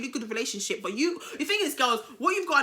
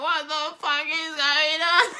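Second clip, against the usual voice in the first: slow, higher wail breaking into laughter; retold anger, laughing; 4 semitones higher, slower than usual. Storyteller re-enacting her anger, laughing wail. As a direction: wail it comically through laughter. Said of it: "I was so angry".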